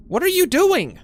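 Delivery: high, loud what are you doing; shrill shouted disbelief; exasperated shout. Real, not acted. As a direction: yell at the screen in disbelief. Yelling at game character.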